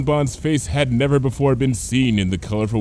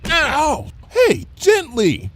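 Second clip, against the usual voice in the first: high yelp, then a slow whiny protest; complaining; 5 dB louder, much slower than usual. Acted. Lyra complaining as Bon Bon headbutts her behind. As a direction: yelp and whine at being shoved. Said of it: "Lyra complained".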